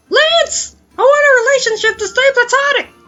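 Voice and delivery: very high, loud, fast cartoon squeal; rapid falsetto outburst; squeaky protest. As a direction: shriek it in a tiny falsetto. Acted lovers' quarrel example.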